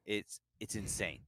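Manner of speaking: usual speaking voice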